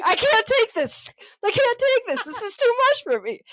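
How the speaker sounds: high, loud mock panic; overwhelmed, hammed-up. Teller in a tense yoga class, mock panic. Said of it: "I was like".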